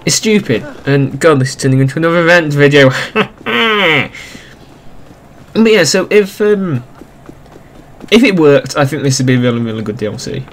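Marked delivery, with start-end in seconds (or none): 2.9-4.2 short high laugh. Real, not acted. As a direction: laugh at your own rant. Laughs at own ranting.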